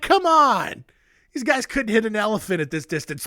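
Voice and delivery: high, hammed-up cocky scoffing; mocking bravado. General boasting just before he is shot, mocking bravado. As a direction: replay the doomed boast with comic swagger. Mock replay of general's last words.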